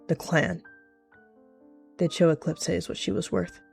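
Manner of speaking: ordinary delivery, nothing marked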